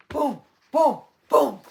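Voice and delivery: loud booming footstep sounds; giant footsteps. Shown as a demonstration. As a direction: stomp each boom out loud. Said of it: "do you see the forest going".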